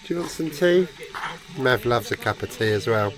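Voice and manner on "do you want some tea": talking to a dog. Real, not acted.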